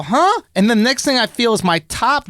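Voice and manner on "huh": puzzled huh. Retold or imitated. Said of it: "I go, I go".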